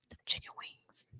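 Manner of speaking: hushed, relishing the food; savouring whisper. Real, not acted. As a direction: whisper it as if still tasting it.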